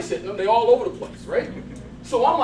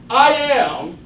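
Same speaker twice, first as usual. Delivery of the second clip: loud affirmation; 7 dB louder, slower than usual. Shown as a demonstration. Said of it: "we're gonna be loud".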